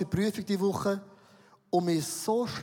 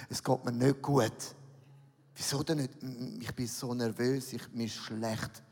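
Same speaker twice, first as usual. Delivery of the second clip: small, lower, softer nervous voice; 8 semitones lower, 5 dB quieter than usual. Retold or imitated. Pastor telling the examiner he feels sick, small and nervous. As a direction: shrink into a scared little voice. Nervous self at exam quoted.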